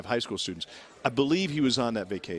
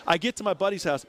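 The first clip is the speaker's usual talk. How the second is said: normal talking voice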